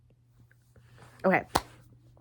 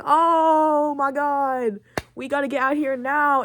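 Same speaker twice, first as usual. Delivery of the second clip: drawn-out, higher-pitched "oh my God"; mock panic; 5 semitones higher, slower than usual. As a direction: imitate outlaws panicking as the law arrives. Gang surprised by the law.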